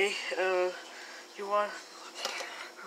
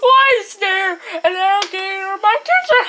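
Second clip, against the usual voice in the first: alarmed shout; 6 semitones higher, 4 dB louder than usual. Acted. Shocked at crocodile.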